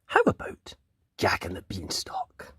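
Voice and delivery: secret whisper; hushed teasing whisper; whispered reveal. Acted. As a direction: breathe the title like a secret.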